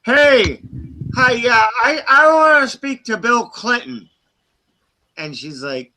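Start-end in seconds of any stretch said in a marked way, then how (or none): 0.0-4.1 higher, louder mock caller voice; mock prank caller. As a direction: ham up the prank caller demanding Clinton.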